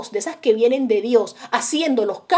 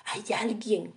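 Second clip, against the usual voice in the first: whisper; 10 dB quieter than usual. Real, not acted. Preacher drops to a secret.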